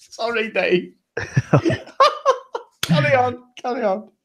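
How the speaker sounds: can't stop laughing while saying sorry; real laughter breaking through the words. Real, not acted. Laughing at goal conceded mid-talk.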